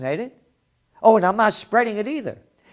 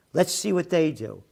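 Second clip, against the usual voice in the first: normal talking voice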